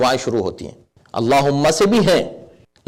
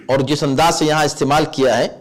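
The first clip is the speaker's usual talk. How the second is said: ordinary talk, nothing marked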